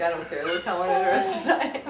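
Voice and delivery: low, lightly voiced bored cat; a hint of a lazy, unimpressed pet; Gary bored. Mother voicing the cat who ignores the baby, mock bored. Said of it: "Gary's like".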